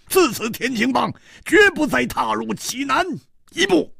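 high, strained, bitter oath; hoarse vow. Acted. Song Gang swearing his gang will stay out of Jinan, hoarse. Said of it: "嘶声说".

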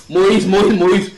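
loud urgent call of a name. Acted. God calling Moses, loud urgent call. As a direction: call the name out, rising each time.

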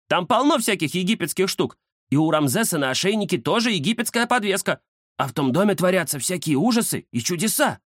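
high-pitched cat character voice; cat Кузя, explaining. Acted. (высокий голос кота, объяснение)

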